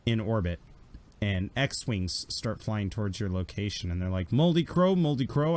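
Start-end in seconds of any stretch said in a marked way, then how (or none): 4.3-5.6 higher, louder radio hail; calling out. Acted. X-Wing pilots hailing the returning ship, raised calling voice. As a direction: hail the ship, name called twice.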